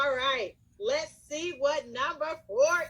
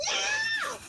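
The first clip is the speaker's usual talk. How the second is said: excited yell. Retold or imitated. She cheers on finding the antlers, very high. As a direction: squeal of triumph.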